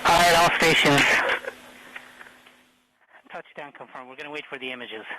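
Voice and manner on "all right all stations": terse radio update. Real, not acted. Flight director addresses all stations.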